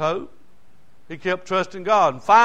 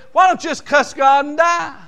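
scornful wife; 7 semitones higher than usual. Retold or imitated. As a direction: toss it off like a bitter wife. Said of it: "his wife says".

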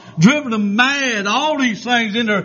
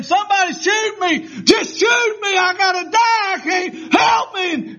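very high, strained imitated dying screams; full-throated mock agony, pitched way up; retold screaming; 12 semitones higher than usual. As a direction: wail it out like a man burning alive. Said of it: "he was screaming and hollering".

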